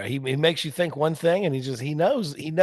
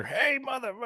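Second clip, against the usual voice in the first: shoot interview Honky; 11 semitones higher, 5 dB quieter than usual. Retold or imitated. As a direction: ham up a shoot interview wrestler. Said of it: "thought he'd be like in shoot".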